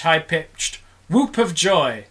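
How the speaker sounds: plain reading voice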